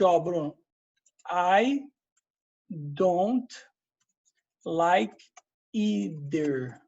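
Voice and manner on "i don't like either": slow, one word at a time with pauses. Shown as a demonstration. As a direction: spell out the sentence word by word. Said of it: "Você pode falar o seguinte". (devagar, palavra por palavra)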